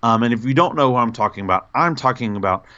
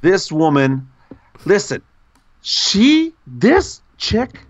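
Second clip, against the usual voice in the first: rising higher and louder with each word; excited build-up; 8 semitones higher, 3 dB louder, slower than usual. Real, not acted. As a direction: build hype, climbing up. Hyping up a fan.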